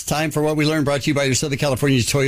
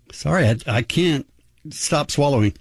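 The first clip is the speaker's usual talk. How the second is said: ordinary talk, nothing marked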